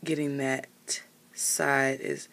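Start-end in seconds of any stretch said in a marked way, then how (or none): none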